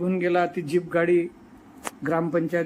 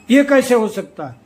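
loud, higher, acted disbelief; angry challenge; 4 semitones higher, 8 dB louder than usual. SDO confronting the talathi over his claim, acted anger. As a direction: re-enact an officer's outraged question.

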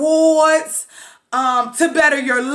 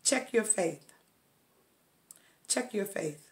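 soft reminder; 7 semitones lower, 16 dB quieter than usual. Real, not acted. Quiet self-reminder, much softer.

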